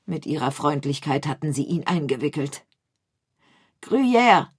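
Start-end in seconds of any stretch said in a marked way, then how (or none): 3.8-4.5 much louder, higher call of the name. A narrator's reading. The rat calls after his friends lost in the tunnel. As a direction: shout the name after friends who vanished. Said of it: "rief er".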